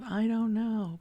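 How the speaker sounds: stuck not knowing. Retold or imitated. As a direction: say it as a frustrated dead end. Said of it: "not from this place of".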